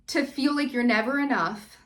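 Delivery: usual speaking voice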